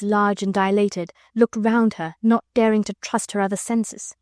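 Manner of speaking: ordinary delivery, nothing marked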